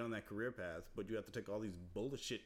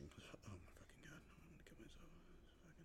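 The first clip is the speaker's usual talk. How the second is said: exasperated whisper; 3 semitones higher, 17 dB quieter than usual. Real, not acted. Student fried from work whispering in despair. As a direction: breathe it out, totally fried. Exhausted groaning after quiz.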